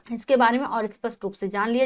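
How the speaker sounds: ordinary talk, nothing marked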